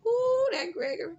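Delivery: high, loud mock wail; mock exasperated cry. Vlogger voicing a castmate groaning about Gregory, loud high wail. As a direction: shriek his name in fake despair. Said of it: "And he said".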